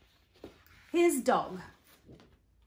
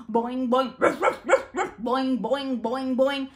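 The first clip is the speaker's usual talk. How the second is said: louder bouncing boings with yappy ruff ruff barks; loud boing and barking; 4 semitones higher, 8 dB louder than usual. Acted. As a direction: bark it out, high and loud, then bounce. Kangaroo hops and dog barking.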